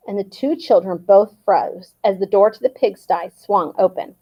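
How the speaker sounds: usual speaking voice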